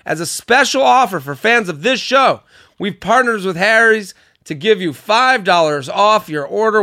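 loud, pumped-up sponsor pitch; hyped ad read. Acted. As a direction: sell it like a radio ad. Ad read with hype.

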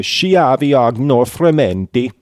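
hammy Italian accent on the phrase; ridiculous Italian accent. Shown as a demonstration. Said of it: "ridiculous Italian accent".

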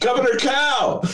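hammed-up announcement; mock shout. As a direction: shout the title like a herald. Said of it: "shouting his title".

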